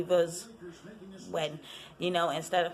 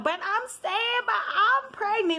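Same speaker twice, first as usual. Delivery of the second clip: mock whiny Portia; 13 semitones higher, 4 dB louder than usual. Portia's whiny excuse imitated.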